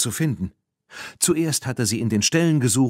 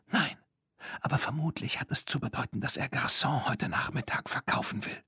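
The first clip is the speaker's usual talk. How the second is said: higher, softer hushed voice; whispering; 5 semitones higher, 6 dB quieter than usual. Acted. Bibi explaining to Claire in the shed, whispering. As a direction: keep it quiet so no one hears.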